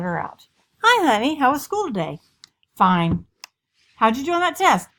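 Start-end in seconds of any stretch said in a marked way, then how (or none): 0.8-2.2 high, loud, chirpy-sweet mother voice; higher, sing-song parental questioning; prying mom. Acted. Acted probing mother questions her teen. 2.8-3.3 loud one-word brush-off; teenager's terse, louder reply; curt teen. Acted. Acted teen shuts mother out. 4.0-4.9 high, loud, chirpy-sweet mother voice; higher, sing-song parental questioning; prying mom. Acted. Acted probing mother questions her teen.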